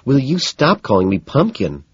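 annoyed. A narrator's reading. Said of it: "she asks, annoyed".

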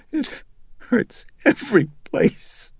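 put-on very high, slow, crying little girl; a hurt child sobbing out her pain; tearful child. A narrator's reading. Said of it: "As the tears streamed down her face".

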